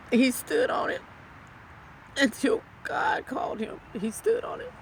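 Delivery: real tearful outcry; crying out. Real, not acted. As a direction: voice cracking high as she cries. Widow affirms husband's devotion.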